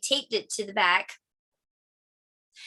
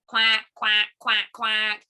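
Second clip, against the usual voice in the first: loud, evenly pitched duck quacks; duck quacking; 3 dB louder, slower than usual. Acted. Story's ducks answer.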